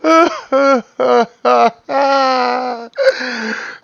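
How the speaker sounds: real laughter, near tears; a burst of real crying laughter; helpless crying laugh. Real, not acted. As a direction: break down laughing until it almost sounds like crying. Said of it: "I broke down crying laughing".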